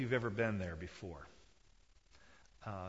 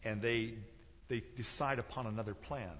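normal talking voice

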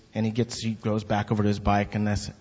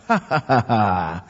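retold laugh; 4 dB louder than usual. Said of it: "I go".

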